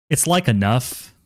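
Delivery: put-on drawn-out Valley girl like. Shown as a demonstration. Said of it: "Sound like a Valley girl".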